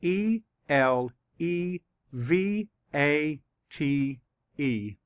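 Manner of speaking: very slow, spaced-out letters; letter by letter, leaving time to write; spelling for dictation. Shown as a demonstration. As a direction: spell slowly, pausing after each letter. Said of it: "Let's write it".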